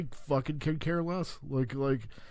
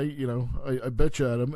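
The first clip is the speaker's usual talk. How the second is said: ordinary delivery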